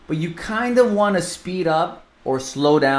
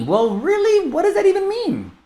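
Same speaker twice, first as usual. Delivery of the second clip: very high, hammed-up questioning; mock incredulous. Answering agents who refuse to adapt, mock incredulous. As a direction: challenge the excuse in a squeaky mock voice. Mocking retort to imagined excuse.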